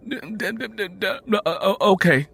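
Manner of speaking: put-on high, stammering sleepy boy; groggy stutter. Just-woken boy answers his mother, high and stammering. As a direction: stumble over the words half asleep. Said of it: "Bud answered groggily".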